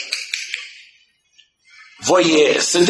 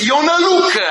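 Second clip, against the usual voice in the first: frightened cry; 11 semitones higher than usual. Retold or imitated. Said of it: "și s-au spăimântat și au zis".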